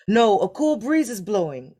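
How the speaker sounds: contrary second son. A narrator's reading. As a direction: contradict him firmly. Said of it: "said the second son".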